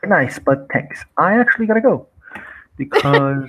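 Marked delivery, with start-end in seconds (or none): 1.2-2.1 hasty excuse. Acted. Escaping the conversation.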